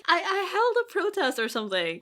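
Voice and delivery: put-on high, bright-eyed eager girl voice; eager Ella. Host voicing Ella to the ogres, high and eager. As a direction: play Ella proudly claiming she protested.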